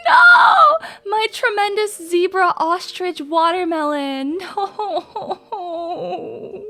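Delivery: shrieking high despair then laughing sob; anguished scream. Retold or imitated. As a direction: wail like your work just vanished. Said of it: "and you're like".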